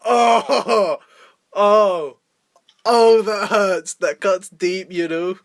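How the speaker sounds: hammed-up wounded sob; fake-cry like the insult really stung; mock crying. Mocking a hurt reaction to a film review. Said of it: "And I'm just like".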